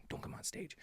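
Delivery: whispered, almost silent. Shown as a demonstration. Telling the babies, a near-silent whisper. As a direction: breathe it to the babies. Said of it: "For all the babies listening".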